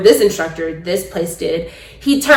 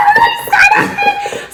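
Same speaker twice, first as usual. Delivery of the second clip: slow, high, drawn-out squeal; much slower than usual. Retold or imitated. Signing forms, squealing about skydiving. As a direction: stretch the word in a gleeful squeal.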